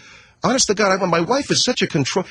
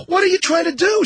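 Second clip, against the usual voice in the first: retold yell. Yelling at screaming wife.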